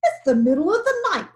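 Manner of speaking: fast, loud angry growl. A narrator's reading. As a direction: snap it out irritated. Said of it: "growled Lion".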